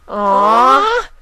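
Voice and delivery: very high, loud disappointed whine. Acted. Foals whining at story time, very high and loud. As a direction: groan like bored kids. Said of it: "The pair whined".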